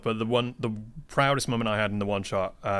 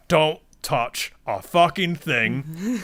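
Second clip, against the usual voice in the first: cautious warning; 5 semitones higher, 5 dB louder, slower than usual. Retold or imitated. Players sneak through a room of mimics.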